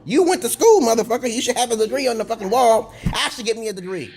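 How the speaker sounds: normal talking voice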